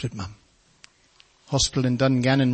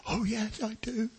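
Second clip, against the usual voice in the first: tearful yes; 9 semitones higher, 7 dB quieter, slower than usual. Retold or imitated. Big man answering tearfully.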